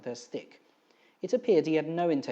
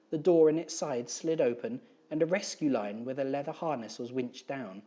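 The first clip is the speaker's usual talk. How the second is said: ordinary delivery, nothing marked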